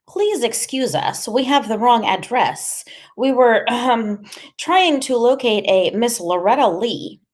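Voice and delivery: put-on high, careful and formal boy's request; slow, halting politeness pitched high; nervously polite Freak. A narrator's reading.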